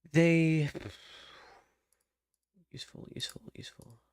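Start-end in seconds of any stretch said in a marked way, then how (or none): 2.7-4.0 whispering. Real, not acted. Muttering to self while working.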